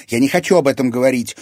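loud, quick, high refusal; heated shutting down of the topic; bristling. A narrator's reading. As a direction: flare up defensively. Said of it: "вскинулась Дельфина".

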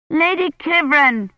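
put-on high, slow little girl's shout; child shouting. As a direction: call out across the snow, child-like. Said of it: "Agnes shouted".

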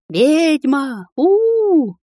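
high booing yell; mob jeering. A narrator's reading. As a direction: jeer and boo at the witch. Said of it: "продолжали кричать люди". (улюлюканье толпы)

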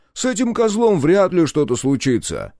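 high call from down the corridor; high, carrying voice; shouting from afar. A narrator's reading. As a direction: holler it scornfully from another room. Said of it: "крикнул из коридора Клаус".

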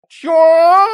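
very loud, high, long bellow; roar. A narrator's reading. Cat Тихон reacting to being cooed at, high roar. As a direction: roar the one word at full force, drawn out. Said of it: "взревел Тихон".

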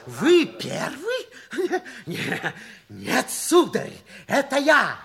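drawn out, scoffing laugh at his claim; mocking laugh. Rival scoffs at Forsythe's claim to be first, mocking laugh. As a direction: laugh him down, very slow.

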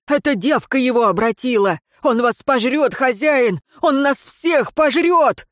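high, urgent warning rising to panic; alarmed exclamation. A narrator's reading. Servant warns master of the undead, mounting alarm. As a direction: build to a frightened cry. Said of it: "воскликнул Гирен".

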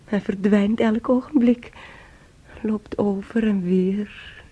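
usual speaking voice

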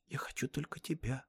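put-on very soft girlish whisper; whispered plea. A narrator's reading. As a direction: whisper it like a lovesick girl. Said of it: "прошептала она". (девичий шёпот)

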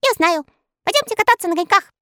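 high, fast eager elf. Acted. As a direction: pipe up with the idea. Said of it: "ответил Скок". (тонкий голосок эльфа)

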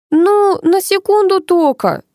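put-on high, slow, drowsy child voice; yawning little girl. A narrator's reading. Little girl excuses her nap to mother, yawning. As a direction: stretch it out like a sleepy child. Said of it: "зевнула малышка".

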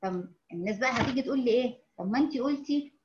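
ordinary talk

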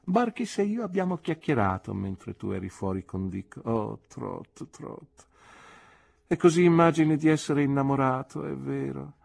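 put-on a knowing old aunt poking fun; teasing aunt. Aunt teases nephew about being in love, knowing aunt voice.

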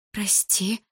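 breathless whispered sorry; whispered apology. A narrator's reading. Malina apologising to Bars, whispered and scared.

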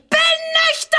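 one high, loud shrieked word; sudden shriek. Acted. Woman shrieks the word at her accusers. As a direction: explode on the word after a pause. Shouted denial.

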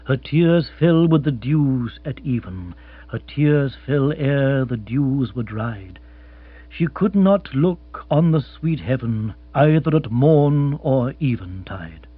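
slow measured verse reading; poem recitation. Acted. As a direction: recite solemnly, unhurried.

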